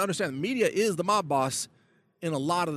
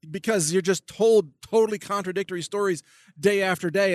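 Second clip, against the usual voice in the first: ordinary talk, nothing marked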